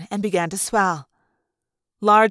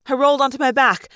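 ordinary delivery

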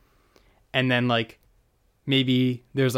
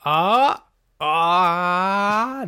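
high, loud excited cries; excited yelp; 8 semitones higher, 6 dB louder than usual. Real, not acted. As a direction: yelp it out in delight. Reacting to the cat burglar idea.

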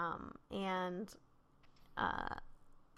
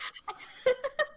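high laugh. Real, not acted.

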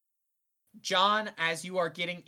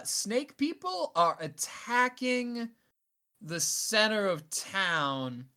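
slow, drawn out; 6 semitones higher, much slower than usual. Acted. Seb announcing the attack slowly.

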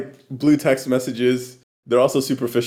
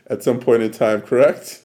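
ordinary talk, nothing marked

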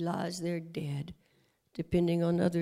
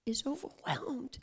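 usual speaking voice

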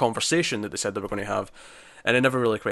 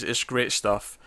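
ordinary talk, nothing marked

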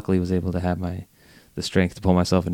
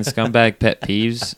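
slow title announce; 3 semitones higher, 5 dB louder, much slower than usual. Acted. Announcing the topic.

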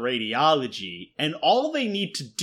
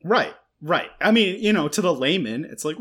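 ordinary talk, nothing marked

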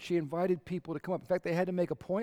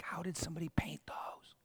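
breathless whisper of wonder; awed whisper; 10 dB quieter than usual. Pretend. Churchgoer marveling at tiny flowers, in a hushed whisper.

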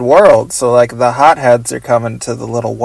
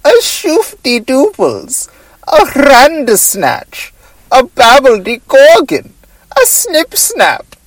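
much higher, squeaky nonsense words; Doctor Bug voice; 9 semitones higher than usual. Pretend. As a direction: spout gibberish like a silly bug. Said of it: "doing the Doctor Bug voice".

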